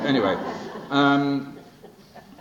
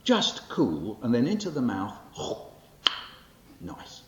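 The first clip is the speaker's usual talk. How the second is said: bright, bouncy storytelling read, higher and louder; lively poem performance; 5 semitones higher, 3 dB louder than usual. Acted. Poet performing his childhood poem to the audience, lively and animated.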